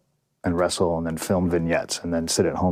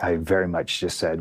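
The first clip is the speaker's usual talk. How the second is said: normal talking voice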